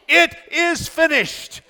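high, slow victor's cry; triumphant shout. Acted. Preacher voicing Jesus' cry from the cross, triumphant shout. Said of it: "triumphant shout of a great victor".

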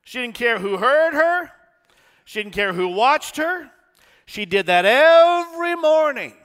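preaching at full force. Acted. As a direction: build the fervor, line by line. Preacher building the story.

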